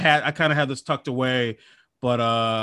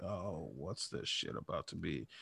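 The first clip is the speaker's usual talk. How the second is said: much softer, low grumbled aside; wary hobbit mutter; 8 semitones lower, 20 dB quieter than usual. Retold or imitated. Hobbit spots a figure at the window, wary low mutter. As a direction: mutter it under your breath. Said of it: "and you're like".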